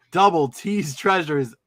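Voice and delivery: ordinary talk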